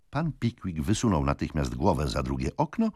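usual speaking voice

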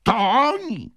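high, loud shout of discovery; excited shout. A narrator's reading. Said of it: "krzyknął Wardle".

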